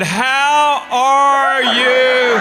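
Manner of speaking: high, extremely drawn-out greeting; mocking slow talk. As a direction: stretch each word as if mimicking her back. Mimics her slow talk back.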